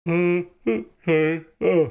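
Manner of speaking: yawning mid-sentence. Real, not acted. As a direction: talk through a yawn. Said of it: "sorry, yawning".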